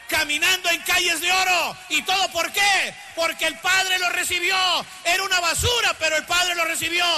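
high, sustained shouted pulpit voice; fervent sermon cry, long drawn-out ending; full-force preaching. Real, not acted. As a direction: preach it out, stretching the phrases.

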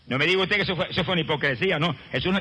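normal talking voice

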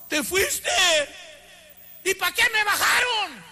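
high, indignant retold complaint; acted angry outburst, drawn out at the end; very annoyed. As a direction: protest as if yanked back, pitched high. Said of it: "Bien enojado".

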